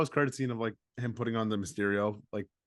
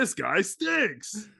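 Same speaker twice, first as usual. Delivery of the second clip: put-on grumpy old man; 8 semitones higher, much slower than usual. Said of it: "Oh, brother".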